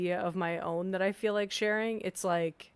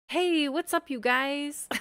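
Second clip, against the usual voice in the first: high, bubbly intro greeting; YouTuber voice; 7 semitones higher, 4 dB louder than usual. Shown as a demonstration. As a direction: greet viewers like a vlogger. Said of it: "customer service voice".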